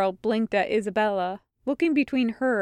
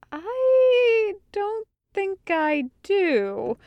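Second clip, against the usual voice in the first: very slow, high, uncertain; faltering; 10 semitones higher, much slower than usual. A narrator's reading. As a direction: stretch the first word out in bewilderment. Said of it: "Meryl faltered".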